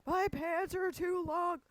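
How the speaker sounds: high, drawn-out whine of an upset daughter; mock crying. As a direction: imitate a girl wailing over a trifle, very high and slow. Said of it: "she's crying because she doesn't like".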